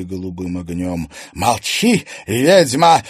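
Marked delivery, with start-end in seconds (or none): 1.3-3.0 loud, raised, angry bark; fierce, forceful order; barked command. A narrator's reading. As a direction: snap at her to shut up. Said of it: "рявкнула Клариса".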